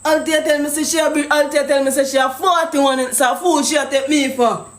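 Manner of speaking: usual speaking voice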